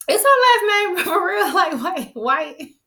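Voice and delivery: high, incredulous question through laughter; laughing disbelief. Real, not acted. Host puzzling over Tracy's last name, laughing disbelief. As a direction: crack up while asking in real disbelief.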